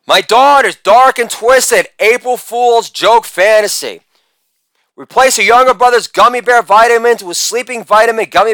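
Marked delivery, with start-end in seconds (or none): none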